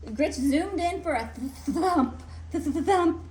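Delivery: plain reading voice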